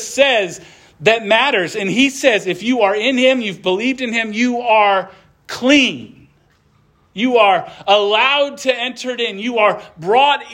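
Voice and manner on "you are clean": each word of "you are clean" drawn out slowly and weighted; slow emphatic. Real, not acted. Pastor assuring believers they are clean, slow and emphatic. Key line of the sermon.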